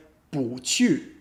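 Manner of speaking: 不去 with 不 rising, then 去 falling; 不去 as a rise-then-fall tone demo; second tone, fourth tone. Shown as a demonstration. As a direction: lift 不 upward, drop 去. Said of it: "it becomes second tone, fourth tone".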